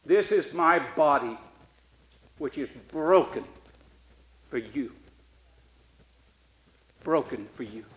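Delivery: hushed communion words; tender and hushed. Acted. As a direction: lay it down gently, reverent.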